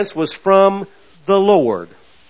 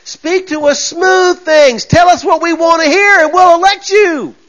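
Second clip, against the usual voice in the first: high, loud mocking appeal, drawn out at first; mock voters' plea; 9 semitones higher, 4 dB louder than usual. Voters telling politicians what they want, high mock shouting. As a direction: cry out like a demanding crowd.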